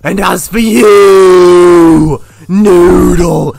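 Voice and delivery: long, drawn-out loud yell; furious shout. Acted. Host turns on Noodle, furious drawn-out shouting. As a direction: bellow slowly, stretching the words.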